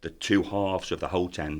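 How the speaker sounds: ordinary talk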